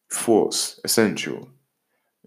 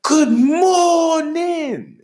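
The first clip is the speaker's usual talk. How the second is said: very high, drawn-out, singsong good morning; cheery greeting; 5 dB louder, much slower than usual. Shown as a demonstration. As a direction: sing it out bright and slow, like a big happy hello. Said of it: "give, give him a".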